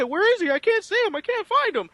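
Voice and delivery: high, loud mock pilot searching the sky; hammed-up pilot crying out where is he; mock pilot panic. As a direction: play a pilot lost in the air, hammed up. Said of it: "They both said".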